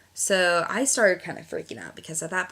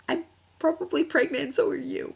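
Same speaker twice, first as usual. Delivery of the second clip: higher, teary blurt of bad news; upset friend; 10 semitones higher, 4 dB louder than usual. Crying friend tells her she may be pregnant, upset and higher.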